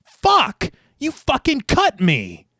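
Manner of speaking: high, loud pained outcry; screaming. A narrator's reading. Wounded skater to his attacker, screaming. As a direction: cry out in shock, voice jumping high. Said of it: "as he screams".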